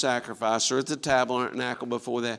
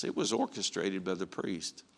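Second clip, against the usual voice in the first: usual speaking voice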